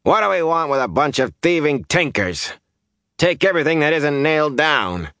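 high-pitched, contemptuous outburst; scornful. A narrator's reading. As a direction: complain scornfully to the whole crowd. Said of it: "Hari's mouth twisted scornfully".

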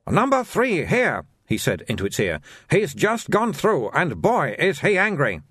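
high-pitched, sing-song sales patter in broken English; haggling like an eager shopkeeper; pushy shopkeeper Soon. Acted. Soon speaks into the dummy.

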